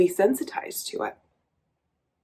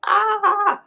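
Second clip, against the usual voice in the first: a sudden high yelp of pent-up energy, retold; release cry; 14 semitones higher, 3 dB louder than usual. To viewers, reliving the moment outside in the sunshine, retold high yelp. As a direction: let the tension burst out in one short cry. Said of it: "and I was like".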